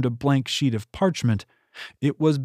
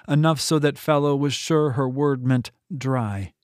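ordinary delivery, nothing marked

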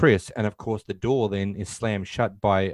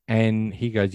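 ordinary talk, nothing marked

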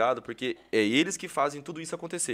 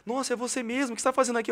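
much higher, mock thrilled; starstruck fan; 9 semitones higher, much faster than usual. Fan meets the host by chance, gushing high voice. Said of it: "a pessoa chega chorando assim".

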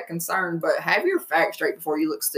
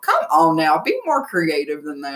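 normal talking voice

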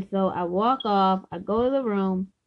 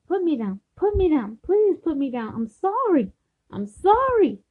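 repeated plea climbing higher; acted pleading; 7 semitones higher, 3 dB louder than usual. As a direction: re-enact begging to be let go, voice rising. Acts out pleading to be released.

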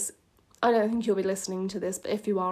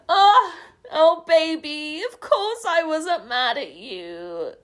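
hammed-up, high, loud opening, slowing at the end; laughing through a line; 7 semitones higher, 7 dB louder, slower than usual. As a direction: wail the soppy line through giggles, drawing it out. Laughs through own soppy dialogue.

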